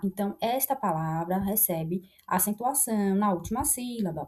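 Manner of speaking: ordinary talk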